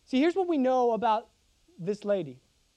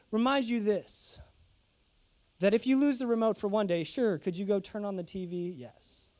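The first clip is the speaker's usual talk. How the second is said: usual speaking voice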